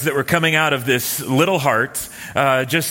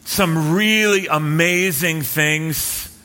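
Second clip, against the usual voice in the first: ordinary talk, nothing marked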